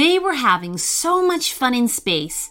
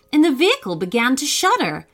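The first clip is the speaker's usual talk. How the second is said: ordinary delivery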